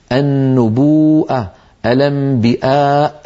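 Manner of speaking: slow النبوءة and الأنبياء, each long vowel followed by a clear hamza; hamza reading. Shown as a demonstration. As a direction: draw out النبوءة as an-nubuu'a, then الأنبياء with its glottal stop. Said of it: "يقرأها مهموزة".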